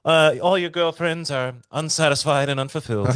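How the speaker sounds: high, loud mocking Irish accent; goofy Irish accent. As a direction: taunt them in a silly Irish voice. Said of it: "in this goofy Irish accent".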